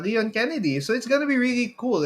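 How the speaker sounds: ordinary delivery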